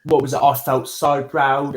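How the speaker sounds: ordinary delivery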